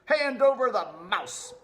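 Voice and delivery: high, loud squeaky rat cry; greedy rat yelling; rat shouting. Acted. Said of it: "shouted another".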